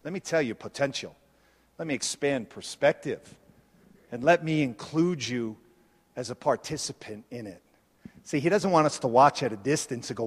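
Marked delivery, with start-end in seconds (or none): 0.0-7.6 Jesus speaking low and soft, quickened; a gentle, intimate voice for Jesus; voicing Jesus. Preacher voicing Jesus inviting the disciples, low and soft. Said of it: "And he's going".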